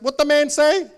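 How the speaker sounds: high, loud broken English; parents' accent. Retold or imitated. Parent with nobody to ask, high and loud. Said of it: "this is my parents".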